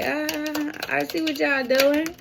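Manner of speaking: high, playful call-out. Pretend. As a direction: tease the spirits, high and bright. Playful voice to the spirits.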